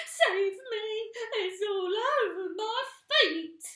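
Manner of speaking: high, loud frantic voice; panicked shriek. Acted. Missus Twit panicking in bed. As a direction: shriek in terror.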